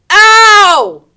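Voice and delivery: a loud, high, hammed-up ow; mock pain cry. Someone in the house gets hurt, a mock yelp of pain. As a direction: yelp like you just got hurt. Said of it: "they go".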